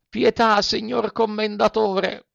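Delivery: high, begging cry for mercy; pleading. A narrator's reading. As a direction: beg at his feet. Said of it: "con voce supplichevole".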